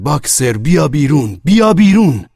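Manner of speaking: loud, urgent call, rising on the repeat; crowd's shout. A narrator's reading. All the animals calling Boxer out, urgent loud shout. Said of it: "همهٔ حیوانات فریاد زدند".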